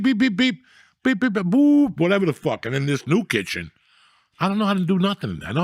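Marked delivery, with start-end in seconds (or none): 0.0-2.0 high mock electronic beeping; imitating beeps. Telling friends about the new kitchen, imitating high appliance beeps. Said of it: "I just kept hearing beep".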